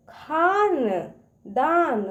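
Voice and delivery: खानदान slow, each syllable apart; sounding out syllables. Shown as a demonstration. Teacher shows kids how to break a word, slowly. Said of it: "उसको तोड़ तोड़ पढ़िए".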